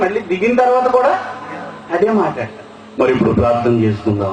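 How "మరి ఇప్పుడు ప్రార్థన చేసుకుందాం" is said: low, fast mock prayer-leader voice; put-on prayer voice. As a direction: drop into an affected prayer voice. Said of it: "ప్రార్థన అనగానే గొంతు మారిపోద్ది".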